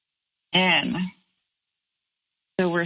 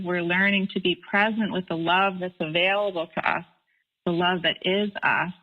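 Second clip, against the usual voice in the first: ordinary delivery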